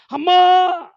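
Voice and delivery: high piercing shouted అమ్మా; shriek. Retold or imitated. Girl screaming at her parents, piercing high shout. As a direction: scream for mother.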